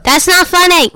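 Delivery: loud, high-pitched protest; indignant shout. Real, not acted. Kid shouting down mom's teasing, loud indignant protest.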